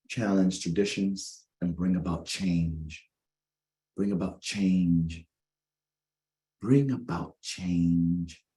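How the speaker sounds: each word spaced out slowly for weight; slow emphatic refrain. Acted. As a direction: land the theme like a sermon line, slowly. Preacher stressing the theme.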